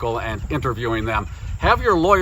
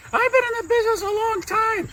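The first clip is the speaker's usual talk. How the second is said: very high, squeaky puppet-like reply; high-pitched comic interviewee; test guest voice; 3 dB quieter than usual. Acted. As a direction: answer in a silly falsetto. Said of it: "this is my test guest".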